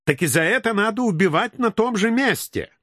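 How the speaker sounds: high, indignant sing-song. Acted. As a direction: demand justice with outrage. Israeli demands killing. (возмущённо, выше обычного)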